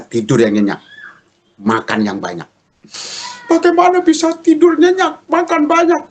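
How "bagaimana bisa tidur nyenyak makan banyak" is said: very high, louder comic wife voice; protesting wife. Retold or imitated. Wife doubts husband's advice to sleep and eat, squealed protest. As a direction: imitate a distressed wife squealing her objection.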